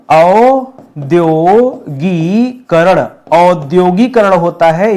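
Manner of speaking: औद्योगीकरण drawn out slowly, opening औ held long. Shown as a demonstration. Teacher slowly modelling औद्योगीकरण for students. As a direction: stretch the औ in औद्योगीकरण. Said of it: "औ, औ".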